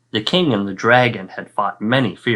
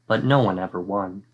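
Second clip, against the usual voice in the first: ordinary delivery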